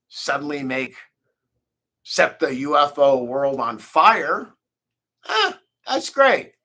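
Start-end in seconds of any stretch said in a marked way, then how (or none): 5.3-6.5 mock enthusiasm. Mock reaction to own fame.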